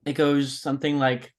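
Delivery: ordinary delivery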